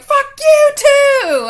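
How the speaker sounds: high, drawn-out screech; very loud, slow, furious retort; retold angry scream. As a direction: reenact screaming back at jeering onlookers. Retold yelling back at jeering crowd.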